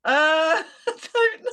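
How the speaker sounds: laughing through the words, high. Real, not acted. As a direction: giggle helplessly while answering. Flustered laugh trying jingle.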